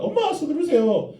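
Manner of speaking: put-on high, loud whiny kid's voice; impatient child. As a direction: nag mom like an eager little boy. Child nagging mother in story. (높고 큰 소리로 조르는 아이 목소리)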